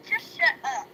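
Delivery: mock snap. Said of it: "she was like".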